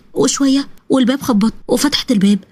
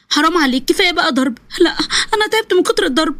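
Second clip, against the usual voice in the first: higher, sobbing through the words; crying plea; 5 semitones higher than usual. Acted. Wife sobbing as she begs her husband to stop the beatings. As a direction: beg him through tears to stop hitting you.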